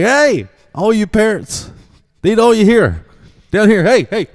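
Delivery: high, loud shout to the crowd; calling out. Retold or imitated. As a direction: call the parents over, no microphone. Coach calling parents.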